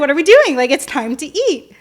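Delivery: loud, high-pitched acted-out exasperation; exclaimed mock demand. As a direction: call it out as a retold dinner plea. Voicing the hungry couple's dinner question.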